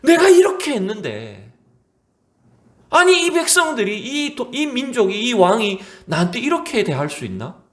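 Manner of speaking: high, aggrieved protest of a wounded prophet; indignant. Acted. Preacher voices Elijah raging at his people and king, indignant. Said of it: "엘리야도 그 이제 분이 나는 거죠".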